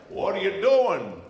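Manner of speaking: loud, high, drawn-out challenge; burly farmer. Acted. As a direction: holler like a farmer catching a trespasser. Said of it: "big, burly farmer comes out and says".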